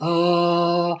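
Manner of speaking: long, steady low held note; demonstrating a low chest tone, held level; low-pitched "oh". Shown as a demonstration. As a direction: sustain a deep, even chest-voice "oh". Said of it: "si vous faites un son grave".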